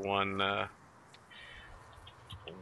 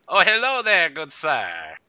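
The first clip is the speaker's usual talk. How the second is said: high, grand dandy villain; mustache twirling villain; 5 semitones higher, 14 dB louder, slower than usual. Acted. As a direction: preen and announce yourself like a rogue. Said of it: "twirling his mustache".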